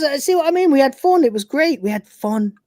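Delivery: high, forced cheerful voice; chirpy fake cheer that dies away; mock cheery note. Reading out a double-crossing note in mock cheer.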